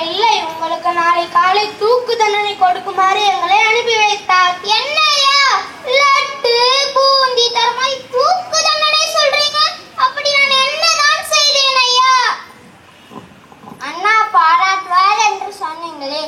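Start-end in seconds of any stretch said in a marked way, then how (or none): 4.8-12.1 high, whiny voice of a frightened gardener; a poor gardener, higher and wailing in disbelief; a gardener pleading his innocence, high and whiny; panicky gardener. Acted. Gardener protests his death sentence.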